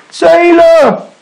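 high, long shouted call. A narrator's reading. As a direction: shout after a departing boat. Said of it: "he shouted".

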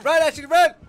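yelling. Real, not acted. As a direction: scream at her to run. Yelling at teammate to flee.

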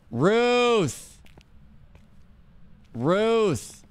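hollering the name into the dark; calling out. Real, not acted. Calling out to the ghost.

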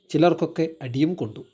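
ordinary delivery, nothing marked

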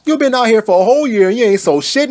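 usual speaking voice

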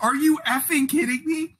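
a hammed-up outburst at the umpire; mock outraged yell. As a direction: scream it like the ump just robbed you. Retold outburst before ejection.

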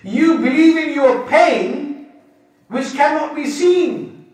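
slow, loud rebuke; forceful challenge. Acted. Preacher challenging the doubter, loud and slow. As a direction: press the point hard and slow.

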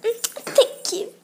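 high, loud, drawn-out mock thanks; exaggerated slow, high-pitched gushing impression; imitating weird-talking mum. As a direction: mimic mum's put-on weird voice, way up high. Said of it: "My mum's pretending to speak weird".

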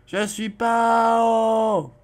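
high and loud, slow, "pas" stretched; hammed-up repeated protest; mock protest.

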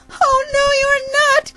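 very high squealed protest; little bird's shrill-pitched refusal; crying out. Acted. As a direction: cry it out at the top of the range. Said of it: "cried the bird".

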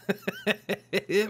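high, stuttering laugh. Real, not acted. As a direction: laugh at the mishap. Laughs at a mishap.

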